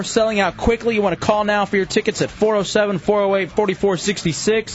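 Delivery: high, punchy event announcement; radio promo read. Acted. Host plugs Monday wrestling tickets, punchy promo voice. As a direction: sell the show like a radio host.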